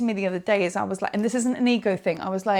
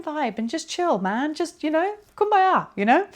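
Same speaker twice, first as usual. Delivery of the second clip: mock hippie kumbaya; 6 semitones higher than usual. Mocking a hippie chill mood.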